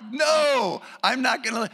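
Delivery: loud, high, defiant shout; shouted protest; defiant refusal. Acted. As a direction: refuse to be silenced. Acting blind men refusing to hush.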